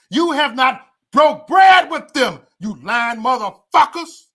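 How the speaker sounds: high, loud, slow furious yell at the critics; angry shout. Real, not acted. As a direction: shout it slowly, each word an accusation.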